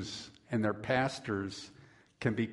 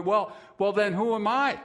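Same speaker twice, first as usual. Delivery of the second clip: louder, higher flustered question; caught off guard; 7 semitones higher, 5 dB louder than usual. Retold or imitated. Pastor surprised by the boy's answer, flustered. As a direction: sound caught out and a bit put out. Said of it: "obviously caught by surprise".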